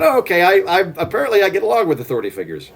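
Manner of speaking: high, sudden realization, then rushed; mock surprise. Retelling his school self, a high mock surprise. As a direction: act out suddenly realizing you're a teacher's pet. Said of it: "I went".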